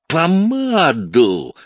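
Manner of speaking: outraged brother howling at his sister; yelled. A narrator's reading. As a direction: bellow it in outrage. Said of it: "завопил мистер Эванс".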